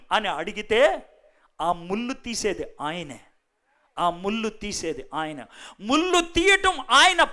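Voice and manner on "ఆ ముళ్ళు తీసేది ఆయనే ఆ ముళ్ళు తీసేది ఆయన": soft reassurance. Real, not acted. Tender reassurance from preacher.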